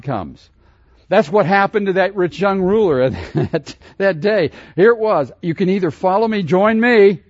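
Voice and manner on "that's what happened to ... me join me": higher and louder, driving the choice home; preaching at full force. Acted. Preacher urging the congregation to choose, full force. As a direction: press the congregation toward a decision.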